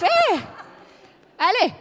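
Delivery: a sudden high excited "hé"; high happy exclamation; excited yelp. Real, not acted. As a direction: squeal at the slide. Her giraffe slide appears.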